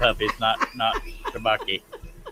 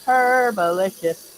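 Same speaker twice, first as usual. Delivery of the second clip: slow, loud, high-pitched hype call; drawn-out cheer; 8 semitones higher, 7 dB louder, slower than usual. Shown as a demonstration. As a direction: stretch it out like a big cheer. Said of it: "Say it with me".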